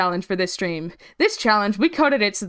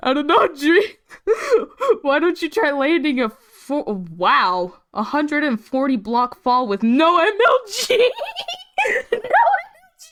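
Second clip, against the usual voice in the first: giggling through the lines; words breaking into laughter; laughing while reading; 7 semitones higher, 4 dB louder than usual. Real, not acted.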